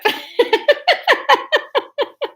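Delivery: high giggle; helpless laughter. Real, not acted. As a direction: burst out laughing at your own attempt. Cracks up at own failed accent.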